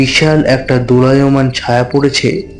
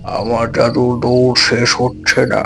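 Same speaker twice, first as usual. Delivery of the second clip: put-on old magician's voice; 2 semitones lower, 3 dB quieter than usual. A narrator's reading. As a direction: speak as a grave old master, unhurried. Old magician recounts his training.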